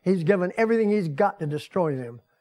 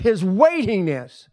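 normal talking voice

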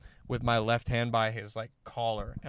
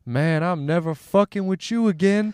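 higher, louder impressed-bystander voice; awed bystander; 7 semitones higher, 7 dB louder than usual. Retold or imitated. Onlooker to the winner after the fight, mock awed cry. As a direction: imitate an onlooker swearing off trouble, pitched up.

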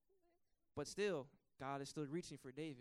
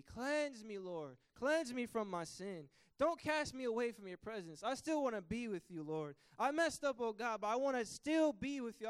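higher, louder pleading prayer; pleading David; 3 semitones higher, 4 dB louder than usual. Acted. Preacher voicing David's plea to God, pleading. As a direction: beg God for another chance. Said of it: "David's approach is".

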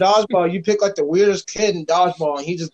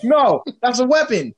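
cop voice; 7 semitones higher, 4 dB louder than usual. Retold or imitated. Mock police reply.

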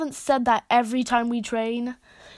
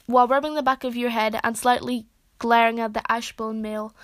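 ordinary delivery, nothing marked

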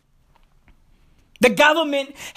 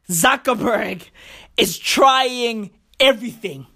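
ordinary talk, nothing marked

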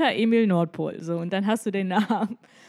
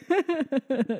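helpless laughing fit; laugh, can't stop; a real laugh breaking through; 6 semitones higher than usual. Real, not acted. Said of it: "ich muss so lachen".

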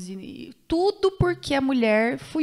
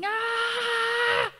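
retold scream; 10 semitones higher than usual. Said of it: "eu dou um grito assim".

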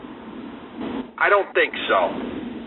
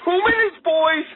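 mock shrill lady. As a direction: squeal it like the annoyed neighbor lady. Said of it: "This middle-aged lady".

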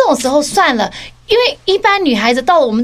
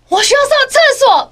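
higher, pleading I need the bathroom; higher mock desperate plea; urgent excuse; 11 semitones higher, 3 dB louder than usual. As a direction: feign sudden urgency. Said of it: "我就说".

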